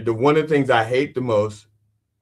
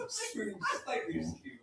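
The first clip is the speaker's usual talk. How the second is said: very soft, higher-pitched echo; hushed; 8 semitones higher, 16 dB quieter than usual. Acted. Fish faking sick so couple won't pick it, hushed. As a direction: repeat it tiny and barely audible.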